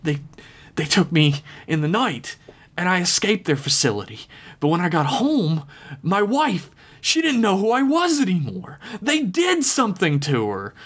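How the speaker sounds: high, anguished cry; desperate ranting. Acted. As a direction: rave about the lost family, near breaking. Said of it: "the man screamed and ranted".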